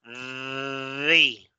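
The with tongue on teeth, buzzing; teacher's model; voiced th. Shown as a demonstration. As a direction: say The vibrating the tongue. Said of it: "y digan".

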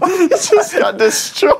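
high, slow and laughing. Real, not acted. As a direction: say it while still laughing. Laughing at defeat.

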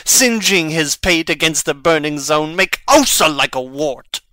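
loud graveside rant. Acted. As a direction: hurl the words like a challenge. Hamlet ranting at Laertes over grave.

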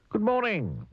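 high-pitched, chirpy hello; high, jolly storybook greeting; cheerful greeting. A narrator's reading. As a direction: sing out a friendly greeting high up. Cheerful Mister Happy greets neighbour.

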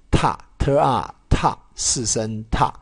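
特啊踏 blended sound by sound, then 四声踏 falling; pronunciation repeat. Shown as a demonstration. Teacher repeating 踏 for students, spelled out. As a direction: spell 踏 again for the class. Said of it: "再念一次".

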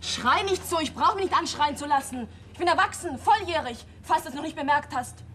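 high, loud angry protest; shouting back. Acted. Daughter yelling back at her shouting father, furious. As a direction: yell back hard, refusing to be cowed.

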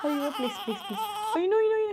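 high laughing please; giggly high ayyo. Real, not acted. Baby starts crying.

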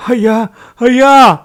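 high, very loud grief-stricken cry; loud anguished wail; wailing shout. A narrator's reading. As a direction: cry out in shock for the master. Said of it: "గట్టిగా అరుస్తూ తలబాదుకుంటూ ఏడవసాగాడు".

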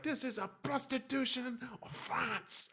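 high, slow, mock-indignant declaration; hammed-up storming-off line; outraged French woman. Leaving the cafe, an imitated French woman in a high, slow parting shot. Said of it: "again says".

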